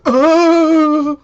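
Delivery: sudden high shrieking yell; mock power scream. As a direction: let out a huge effortful scream like a burst of power. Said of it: "Did he go".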